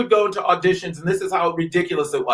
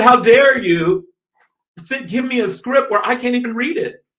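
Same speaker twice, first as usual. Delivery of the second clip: indignant retelling; 7 semitones higher, 3 dB louder than usual. Retold or imitated. Actor recounts confronting a director over the script, indignant. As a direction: retell an affronted challenge, pitch raised.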